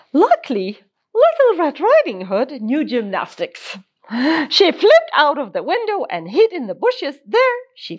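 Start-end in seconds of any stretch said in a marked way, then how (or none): none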